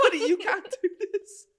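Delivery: high, loud laughing buddy; laughing scold. Retold or imitated. Comedian telling off the naked man, laughing scold. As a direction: scold him through laughter. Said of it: "Like, like".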